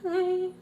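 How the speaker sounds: high gasp of fright; scared gasp. Retold or imitated. Narrator reliving getting caught out, scared gasp. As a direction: suck in a frightened breath. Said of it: "I got so scared. I was like".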